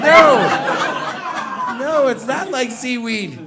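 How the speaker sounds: slow laughing denial; refusing the fish story, slow; exasperated no. Retold or imitated. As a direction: drag out the denial. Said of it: "I'm like".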